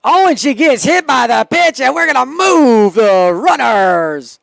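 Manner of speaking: loud, high, last words drawn out; excited play-by-play shout. Real, not acted. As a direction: shout the call, stretching the ending. Calls hit batter live.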